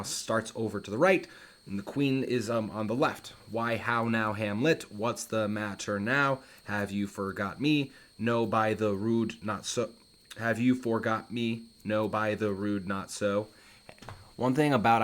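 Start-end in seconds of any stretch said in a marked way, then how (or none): 3.5-13.5 slow, evenly measured verse reading; iambic pentameter demo. Shown as a demonstration. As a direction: sound out each beat of the line, unhurried. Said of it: "speaking in iambic pentameter".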